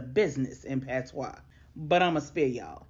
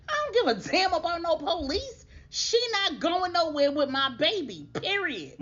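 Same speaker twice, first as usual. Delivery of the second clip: higher, louder heated defiance; defiant outburst; 11 semitones higher, 3 dB louder than usual. Retold or imitated. Donovan brushing off the police threat, loud heated defiance. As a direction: shout him down, dismissive.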